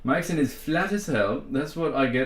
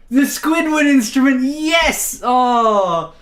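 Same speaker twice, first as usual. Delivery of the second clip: high, loud, thrilled exclamation; excited; 9 semitones higher, 8 dB louder, slower than usual. Real, not acted. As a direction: burst out in delight at the instrument. Excited reveal of the clarinet.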